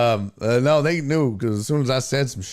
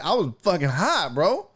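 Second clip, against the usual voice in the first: ordinary talk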